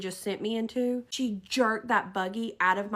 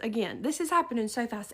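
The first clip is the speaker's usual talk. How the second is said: ordinary delivery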